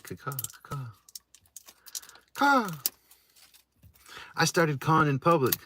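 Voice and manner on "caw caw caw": high screeching crow caw; bird caw imitation. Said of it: "Woody said".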